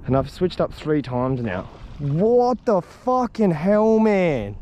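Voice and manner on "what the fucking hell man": high, slow, drawn-out frustrated cry; real exasperation at fish refusing bait; exasperated outburst. Real, not acted. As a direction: let the frustration burst out high. Frustrated angler, fish won't bite.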